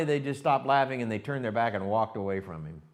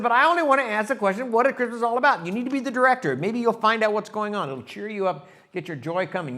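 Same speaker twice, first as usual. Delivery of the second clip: mock excited kid voices; 10 semitones higher, 9 dB louder than usual. Charlie Brown and Lucy dialogue.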